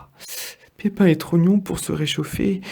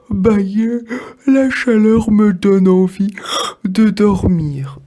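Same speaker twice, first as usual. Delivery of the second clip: louder, high fake yawn, slow at the end; sheepish bird; 5 semitones higher, 6 dB louder than usual. The bird fakes a yawn to the apples, sheepish. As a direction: feign drowsiness to cover up. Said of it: "il s'éloigne tout penaud".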